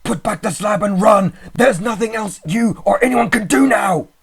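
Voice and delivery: high, loud, desperate scream; despairing scream. A narrator's reading. Friend screaming to narrator to stay away, despairing. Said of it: "changed to a scream to utter despair".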